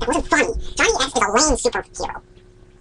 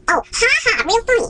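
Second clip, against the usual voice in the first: mock laugh, high and dry; sarcastic; 8 semitones higher than usual. Real, not acted. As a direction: sneer ha ha, real funny. Sarcastic reaction to joke.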